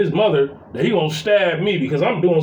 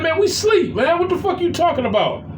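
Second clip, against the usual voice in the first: high, exasperated pitch at usual volume; retold indignant protest; 9 semitones higher than usual. Stepdad retells protesting to stepson that they were asleep, exasperated. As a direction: act out being woken up and annoyed.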